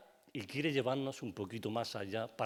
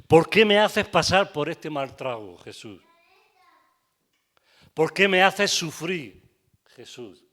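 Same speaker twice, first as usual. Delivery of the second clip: half-acted grief, louder on each question; anguished complaint; 4 semitones higher, 6 dB louder than usual. Voicing Marta's complaint to Jesus. (queja angustiada en voz alta a Jesús)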